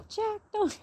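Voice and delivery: jokey high-pitched cry of Jack's name, a quick Rose bit; playing Rose from Titanic. Cold outside, joking as Rose calling to Jack. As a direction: squeal it like the heartbroken heroine. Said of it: "I feel like, what's her name, Rose off of Titanic".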